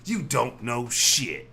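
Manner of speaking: slow, loud mock holler; hammed-up cranky old-man shout. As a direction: holler it at passers-by, drawn out. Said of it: "just, like, shouting at people like this".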